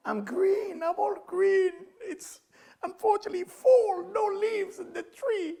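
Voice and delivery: very high, mock wailing voice; hammed-up high, tearful lament; lamenting flower. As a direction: whine it like a melodramatic little plant.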